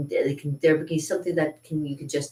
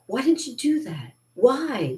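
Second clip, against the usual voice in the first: much higher, outraged questioning; exasperated demand; 8 semitones higher, slower than usual. Retold or imitated. Confronting the friends who watched her struggle, outraged and much higher. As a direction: throw the question at them in disbelief. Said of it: "And I'm like".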